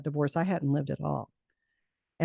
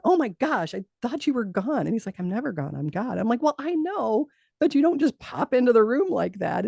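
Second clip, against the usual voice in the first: startled protest; 7 semitones higher than usual. Retold or imitated. Woman startled when God pops in, high startled scolding. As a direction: squeal it like you were caught off guard.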